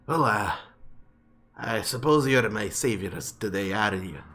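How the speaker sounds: a hint of sour dwarf voice; grudging dwarf. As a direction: grumble like a bitter dwarf.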